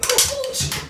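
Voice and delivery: high panicked swear; real startled fear; frightened high cry. Real, not acted. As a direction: blurt it in sudden fright. Scared, fleeing bathroom.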